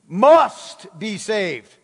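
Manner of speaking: "Must" drawn out slow and loud; stress on MUST. Real, not acted. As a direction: hit "Must" hard and stretch it. Preacher contrasts must with can, could.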